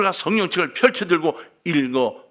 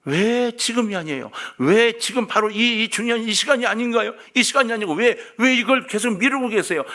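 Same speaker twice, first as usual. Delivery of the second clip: ordinary talk, nothing marked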